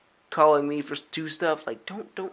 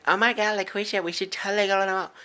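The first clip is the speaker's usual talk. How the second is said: high, loud mock girl squeal; hammed-up valley girl gushing; 8 semitones higher, 3 dB louder than usual.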